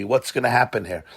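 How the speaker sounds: ordinary delivery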